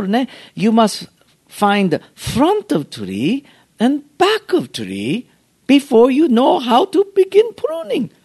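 high, slow imitated Japanese accent; Mister Miyagi. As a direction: spell out the lesson as a mock bonsai master. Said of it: "And he said".

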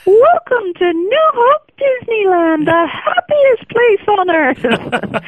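very high, slow, sing-song greeting; mock the pastor's squeaky welcome; teenage girl mocking the pastor's high voice, very high and slow; high-pitched pastor voice. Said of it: "he always had that high-pitched voice".

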